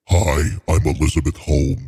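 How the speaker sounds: exaggeratedly deep put-on voice; Elizabeth Holmes. Podcast host mocks a founder introducing herself, very deep impression. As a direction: drop the voice way down, mock introduction. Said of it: "way down there now".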